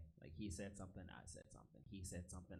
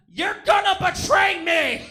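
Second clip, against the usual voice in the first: slow, screaming accusation, far higher and louder; wild accusation; 30 dB louder, slower than usual. Pretend. Jesus at the dinner shrieking accusations at his friends. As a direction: hurl the betrayal at the whole table. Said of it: "making wild accusations".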